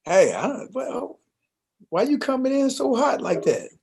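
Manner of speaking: a hint of offended partner; defensive retort. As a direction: push back like you've been wronged. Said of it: "And you say to me".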